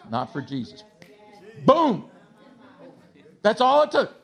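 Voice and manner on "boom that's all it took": sudden loud, high burst; emphatic exclamation. Real, not acted. Preacher to congregation marvelling at an instant miracle, emphatic exclamation.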